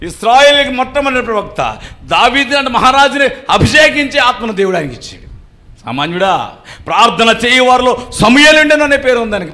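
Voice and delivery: full-force sermon voice; fervent preaching. Acted. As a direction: preach it out to the congregation. Preacher extols Samuel.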